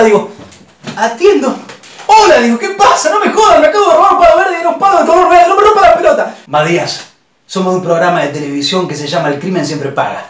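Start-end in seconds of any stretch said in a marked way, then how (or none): 2.1-6.3 higher and louder, fast; irritated shout. Retold or imitated. Answering the phone, annoyed, higher and louder. 6.5-10.2 much lower, calm and smug; the man on the phone. Retold or imitated. The host telling Matías he fell for it, low and smug. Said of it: "the man on the phone".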